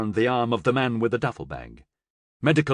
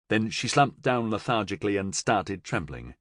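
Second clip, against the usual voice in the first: ordinary talk, nothing marked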